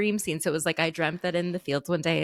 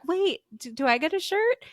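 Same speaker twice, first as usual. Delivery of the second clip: much higher, plaintive question; whiny younger self; 10 semitones higher than usual. Retold or imitated. [name] asking mom about a shirt, high and plaintive. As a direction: ask it like a left-out kid. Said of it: "And I was like".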